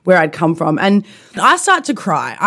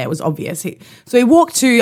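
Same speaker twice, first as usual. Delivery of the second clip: normal talking voice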